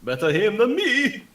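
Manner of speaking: high, loud call; in-character quip. Acted. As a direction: shout it out gleefully as the character. Player calls out in character.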